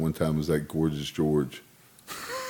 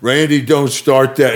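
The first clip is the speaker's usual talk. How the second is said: loud, raised-pitch retold plea; retold protest; 7 semitones higher, 9 dB louder, slower than usual. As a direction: act out her slow, exasperated objection. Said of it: "She goes".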